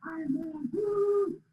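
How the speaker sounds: high-pitched cheer at held-back volume; small, high mock scream of excitement; excited mock yell. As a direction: imitate an overexcited racer's whoop. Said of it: "he's screaming and yelling, he's all excited".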